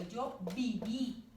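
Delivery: slow yo viví, last í of viví hit hard; stressed final í. Shown as a demonstration. Teacher dictating the accented form to pupils, slow. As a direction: drag out viví, landing on the accented í. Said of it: "con acento".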